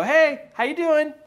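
high, loud hallway hello; imitated boy's bold greeting; confident greeting. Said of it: "acting confident to this bully".

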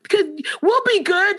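put-on high childlike plea; pleading kids. Crying students begging teacher, high childish plea. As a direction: beg like a small child trying to be good. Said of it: "they started saying".

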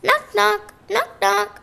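voiced knock knock; knocking sound. A narrator's reading. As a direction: mimic a door knock.

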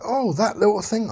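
sudden high-pitched clown; imitating Pennywise brushing it off sweetly. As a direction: swoop up high like a sly clown. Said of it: "And he's like".

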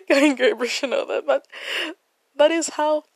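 fighting back tears mid-sentence; choked up. Real, not acted. To the listeners, choking up with emotion. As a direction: voice catching with real emotion. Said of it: "and I'm getting emotional".

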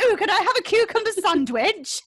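high, loud mock posh English voice; mincing toff asking for a sandwich; posh British accent. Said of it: "when you're doing it of us".

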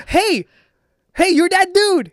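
high, loud, gushing fan squeal; starstruck fan. Retold or imitated. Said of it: "I did the most fan-stricken".

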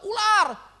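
retold alarm cry. Preacher retelling a snake interrupting class, sudden mock shout.

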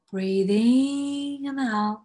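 slow breathing cue. Acted.

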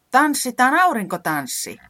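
high, loud eager call; exclaimed. Acted. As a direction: call it out gleefully. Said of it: "Reporankka huudahti". (innokas huudahdus)

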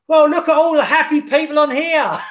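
teasing shout out. Retold or imitated. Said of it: "so I just shout out".

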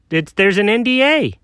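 ordinary delivery

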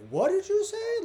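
high, disbelieving cry; shocked. Retold or imitated. Hearing the stranger call him useful dead, high shocked disbelief. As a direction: shoot up in disbelief.

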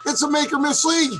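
ranting. Real, not acted. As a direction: hammer the slogan back at him. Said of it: "That's calling out the incompetence".

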